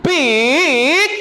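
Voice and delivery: high, wavering drawn-out call; seruan bernada. Shown as a demonstration. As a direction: stretch it high, swooping up and down. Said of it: "ada nadanya, ada intonasinya". (seruan panjang, tinggi meliuk-liuk)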